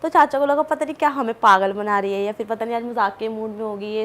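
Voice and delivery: usual speaking voice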